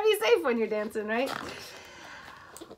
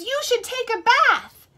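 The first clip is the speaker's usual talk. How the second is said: high, louder hippo voice; bubbly hippo suggestion, higher; 12 semitones higher, 5 dB louder than usual. A narrator's reading. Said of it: "The hippo says".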